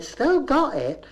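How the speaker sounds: high, slow Bristol reply; Bristol cleaner. Retold or imitated. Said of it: "She said".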